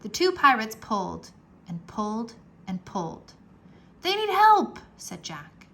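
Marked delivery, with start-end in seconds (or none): 4.0-4.8 put-on high, loud boyish voice; bright young boy calling out; young boy urging; Jack's voice. A narrator's reading. Jack voiced in read story.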